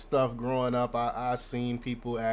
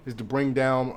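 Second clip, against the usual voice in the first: ordinary talk, nothing marked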